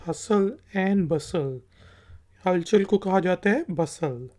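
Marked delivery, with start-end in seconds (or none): 0.1-1.6 हसल and बसल said slowly, T silent; slow phrase. Shown as a demonstration. Teacher says the phrase slowly for students. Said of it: "यह है बसल".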